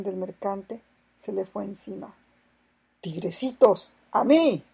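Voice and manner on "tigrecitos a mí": shouting. A narrator's reading. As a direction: call the crew to him. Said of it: "gritó". (grito fuerte de llamada)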